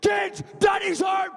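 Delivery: loud, high, drawn-out holler; aggressive yell. Acted. Enraged dad bursting in on his kids, screamed announcement. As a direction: bellow the announcement at full pitch. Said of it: "quite an aggressive way to end".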